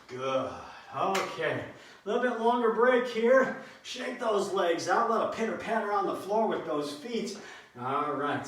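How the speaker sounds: usual speaking voice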